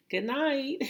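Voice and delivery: high, loud, cheery singsong; sing-song good night. Retold or imitated. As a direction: croon it like tucking someone in. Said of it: "they're like".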